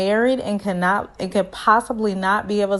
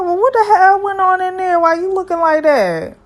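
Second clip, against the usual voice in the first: high, loud, disbelieving question; shocked; 10 semitones higher, 5 dB louder than usual. Retold or imitated. Asking Ray why he looks so traumatised, high and shocked. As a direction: voice jumps up in disbelief. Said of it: "I was like".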